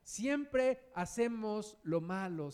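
normal talking voice

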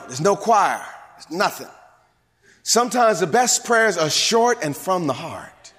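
normal talking voice

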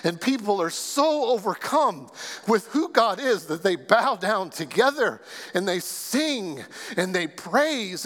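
ordinary talk, nothing marked

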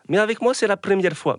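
mock the coach's German accent. Pundit quoting the coach's excuse, in a put-on German accent. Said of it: "avec son si bel accent".

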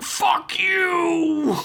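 very high, drawn-out mock yell; hammed-up outburst. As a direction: imitate a squealed angry retort. Acted angry outburst of Joe.

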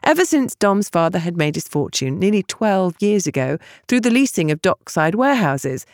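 ordinary delivery

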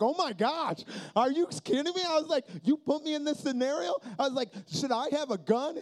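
panicked at the lookouts, high and loud; mock panic. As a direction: freak out that he could get shot. Panics at danger of being shot.

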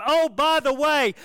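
high, loud mocking shout; yelling back. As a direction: taunt him as you ride away. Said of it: "he yelled back at him".

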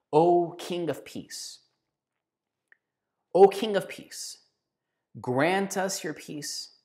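a reverent, drawn-out prayer; slow liturgical prayer. Acted. Leading the people in slow liturgical prayer. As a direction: intone it slowly, like a chant.

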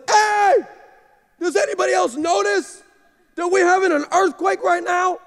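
high, loud call to the whole table; shouting the question. Retold or imitated. Pastor calling out to his breakfast hosts about the earthquake, loud shout. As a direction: yell out to get everyone's attention. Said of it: "So I said".

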